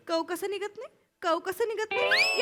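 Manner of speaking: whiny mimicry. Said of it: "आरशासमोर उभं राहून नुसतं".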